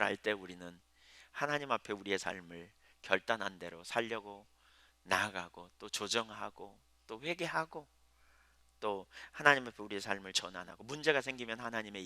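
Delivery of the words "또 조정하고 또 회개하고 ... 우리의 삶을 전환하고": ordinary talk, nothing marked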